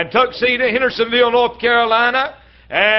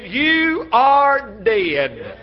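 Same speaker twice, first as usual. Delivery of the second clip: very slow, each word weighed; slow solemn verdict; much slower than usual. Retold or imitated. Preacher quoting God to his congregation, very slow and grave. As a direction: let the verdict land word by word. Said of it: "But God said".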